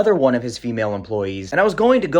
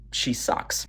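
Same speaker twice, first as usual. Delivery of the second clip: whispered aside; 3 semitones lower, 8 dB quieter than usual. Real, not acted. Snide aside about Linda.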